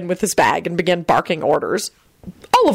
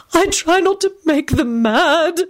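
miserable whisper; 10 semitones higher, slower than usual. A narrator's reading. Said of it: "whispered miserably".

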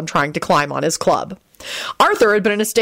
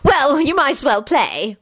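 high, drawn-out snippiness; high-pitched, put-out concession; snippy; 8 semitones higher, slower than usual. A narrator's reading. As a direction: give grudging leave, snippily. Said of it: "Molly finally said snippily".

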